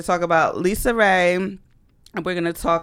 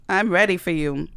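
ordinary talk, nothing marked

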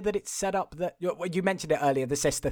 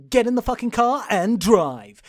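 louder, higher curt order to a driver; forceful command; 4 semitones higher, 7 dB louder than usual. Retold or imitated. Patient ordering his doctor to drive, forceful command. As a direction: order her firmly into the car. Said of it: "the people who's in her meetings goes".

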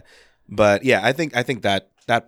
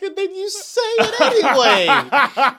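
much higher squeaky outraged laugh, slowed; shocked disbelief; 4 dB louder, slower than usual. Real, not acted. Shocked at dark joke.

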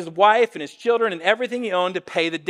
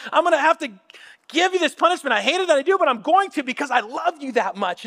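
lightly acted parent, higher pitched; reluctantly firm with a child, voice raised in pitch; earnest loving parent; 6 semitones higher than usual. Parent explaining loving punishment to child.

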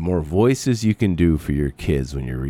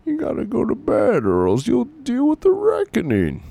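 loud, high-pitched hammy villain voice; big, swooping put-on menace, high and loud; Bane; 6 dB louder than usual. Shown as a demonstration. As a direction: threaten bedtime like a comic-book villain. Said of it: "And I was doing".

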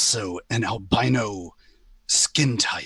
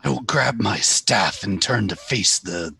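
usual speaking voice

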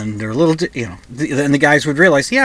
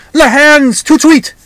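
high, loud, hammed-up French accent, clapping for service; outrageous French accent; 14 semitones higher, 9 dB louder than usual. Maître d' summons staff to wash her hands, loud hammed French accent. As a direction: bark the order like a pompous French maître d', thick French accent. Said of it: "outrageous French accent".

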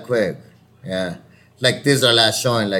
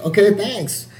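higher, quick polite thanks; breezy sign-off; 8 semitones higher, slower than usual. Retold or imitated. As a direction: toss off a chirpy goodbye. Acted breezy audition sign-off.

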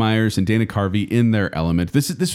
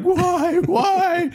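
very high, wailing cry of why; mock crying wife. Imitating the crying wife in the sketch, a high mock wail. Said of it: "his crying wife is like".

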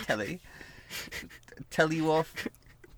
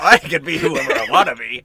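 laughing; 12 dB louder than usual. Real, not acted. Laughing defiance after teasing.

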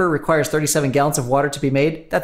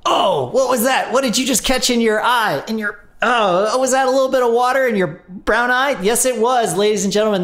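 excited; 7 semitones higher than usual. Acted. Over-the-top ad gag.

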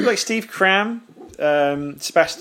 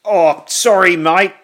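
high, loud Aussie apology; Aussie detective sheepishly saying sorry; Australian accent; 9 semitones higher, 12 dB louder, slower than usual. Retold or imitated.

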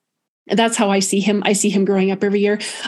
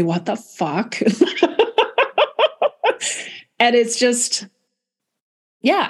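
laughing; 3 semitones higher, slower than usual. Retold or imitated. Retold joke ending in laughter.

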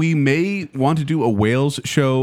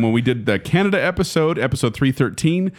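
ordinary delivery

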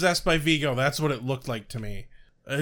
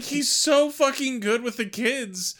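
usual speaking voice

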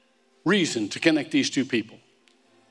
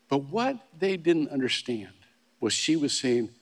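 ordinary delivery

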